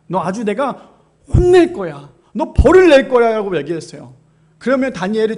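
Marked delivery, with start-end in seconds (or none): 0.1-3.3 louder, shouted warning of punishment; loud threat. Acted. 다니엘에게 소리치는 협박. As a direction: officials shouting a threat at Daniel.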